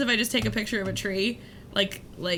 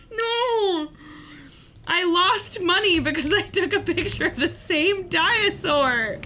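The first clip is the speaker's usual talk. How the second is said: dismayed outcry; 7 semitones higher than usual. Real, not acted. Streamer penalized by the game, high dismayed cry. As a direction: wail at the unfair penalty.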